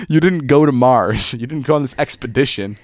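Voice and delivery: ordinary delivery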